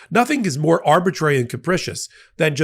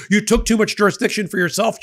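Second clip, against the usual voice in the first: high-pitched mock accusation; pitched-up, put-on reproach; mock scolding; 7 semitones higher than usual. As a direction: imitate someone telling off a judge. Paraphrases the dissent scolding the judge.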